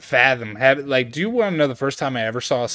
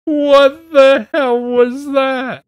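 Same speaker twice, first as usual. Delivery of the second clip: very high, slow, loud mock shriek; hammed-up horrified reaction, pitched way up; retold shocked outburst; 6 dB louder, much slower than usual. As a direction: draw each word out in squealed disbelief. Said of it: "I was like".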